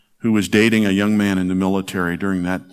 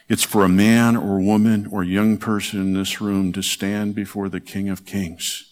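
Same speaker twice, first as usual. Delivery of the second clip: ordinary delivery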